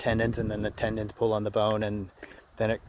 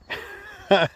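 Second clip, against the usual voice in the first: breathy little laugh; quick amused chuckle; 8 semitones higher, 3 dB louder than usual. Real, not acted. Laughing at own situation.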